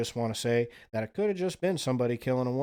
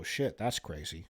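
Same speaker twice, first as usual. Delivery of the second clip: usual speaking voice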